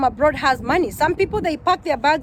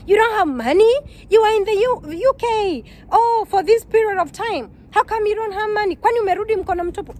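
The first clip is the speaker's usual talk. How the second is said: mocking disbelief; 6 semitones higher than usual. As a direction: act shocked and scornful that she came back broke. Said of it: "kind of mocking you".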